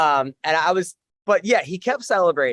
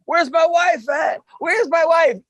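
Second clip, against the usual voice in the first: hammed-up fighter shout; 8 semitones higher, slower than usual. Scene: fighter on mic after win shouting for wife, hammy. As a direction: bellow it like a wrestler after the win. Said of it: "And he goes".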